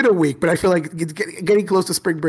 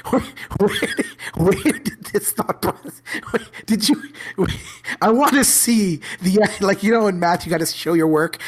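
laughing; 5 semitones higher than usual. Real, not acted. Teacher retells questioning a student's logic, struggling through laughter. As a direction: try to question the student while cracking up. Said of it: "I'm laughing now".

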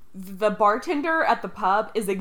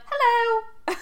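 high-pitched British hello; British accent. Retold or imitated. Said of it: "You are talking in a British accent".

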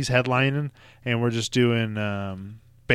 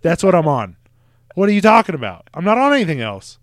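exasperated insistence; 7 semitones higher, 9 dB louder than usual. Retold or imitated. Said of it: "And I'm like".

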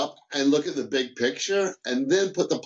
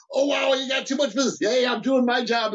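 higher-pitched mock gloating; playful rival banter; 9 semitones higher than usual. Department rival ribbing the other over workload, playful banter. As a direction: tease a colleague, pitched up and quick.

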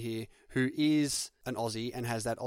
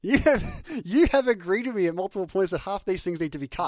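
laughing while insisting, a bit louder; laughing protest; 7 semitones higher, 7 dB louder than usual. Real, not acted. Laughing as he reminds his co-host she agreed. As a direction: push back through a laugh.

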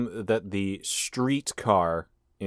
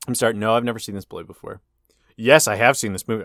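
ordinary delivery

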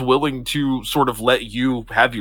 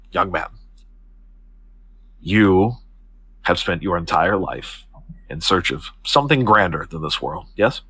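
lower, measured, formal woman; composed Octavia; 5 semitones lower than usual. Acted. Octavia puts her hands on the young man's shoulders, composed. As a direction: speak low and deliberately, with poise. Game master voices Octavia addressing Elk.

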